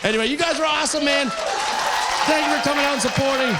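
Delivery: loud, fast, high sign-off; rally the crowd; calling out thanks. Real, not acted. Comic thanks the crowd at the end, shouting fast and high. Closing the set to audience.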